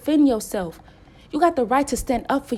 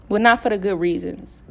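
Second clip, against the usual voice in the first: usual speaking voice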